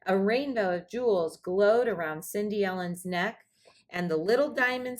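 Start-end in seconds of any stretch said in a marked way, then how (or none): none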